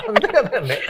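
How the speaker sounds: squeaky high laugh through the words; high giggling reply. Real, not acted. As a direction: burst out laughing as you say it.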